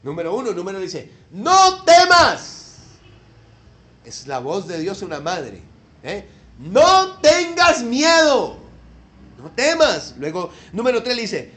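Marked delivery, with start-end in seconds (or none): 1.3-2.8 loud, slow and high "No temas"; God comforting Hagar, loud and drawn out; booming divine voice. Acted. Voice of God repeated. 6.6-10.2 loud, slow and high "No temas"; God comforting Hagar, loud and drawn out; booming divine voice. Acted.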